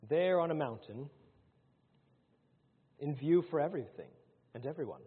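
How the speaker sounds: ordinary delivery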